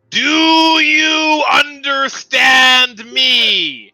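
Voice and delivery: huge, drawn-out shout, pitched high; thunderous, stretched-out titan yell; booming giant. Acted. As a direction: bellow each word very slowly at someone tiny. Giant titan addresses tiny person.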